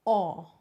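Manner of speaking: loud open "aw", mouth wide; open o sound. Shown as a demonstration. Teacher showing the O sound loudly. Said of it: "open your mouth and say".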